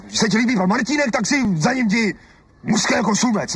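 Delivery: sulky jealous jab; jealous snap. Acted. Husband tells wife to go after the neighbour, jealously.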